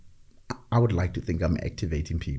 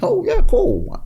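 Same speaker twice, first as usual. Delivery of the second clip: mock surprise; 7 dB louder, slower than usual. Mock casual reply to stranger.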